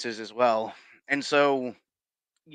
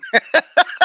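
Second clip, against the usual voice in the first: high, louder burst of laughter; 10 dB louder than usual. Real, not acted. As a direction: crack up at the joke. Laughs at own joke.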